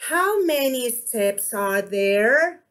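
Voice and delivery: drawn-out question ending upward on "there"; question intonation. Shown as a demonstration. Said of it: "That is the intonation".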